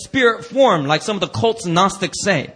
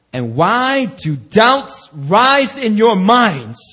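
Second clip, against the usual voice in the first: very slow, louder drawn-out question; 3 semitones higher, 6 dB louder, much slower than usual. Retold or imitated. Jesus asking doubting disciples, slow drawn-out rebuke. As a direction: stretch each word as a rebuke. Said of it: "So he said to them".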